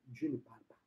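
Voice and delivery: very low, very soft repeat; hushed. Acted. Preacher on God's silence to believers, hushed. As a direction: let it sink almost to nothing.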